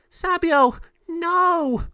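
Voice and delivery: very high, loud, desperate scream; anguished cry. Acted. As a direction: cry out as her friend is trapped. Mariana sees Sabio trapped.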